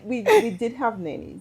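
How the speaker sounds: ordinary delivery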